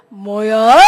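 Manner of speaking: loud, imitated gangster challenge; scowling thug. Gangster confronting the preacher over litter, scowling and loud. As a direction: act a thug taking offence. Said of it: "탁 인상 찌푸리면서".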